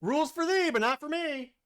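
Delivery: high, loud mocking taunt; teasing singsong. Real, not acted. Teasing chant.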